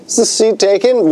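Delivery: lightly voiced attendant asking sweetly; high mock flight attendant. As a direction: imitate a polite attendant. Quoting flight attendant on plane.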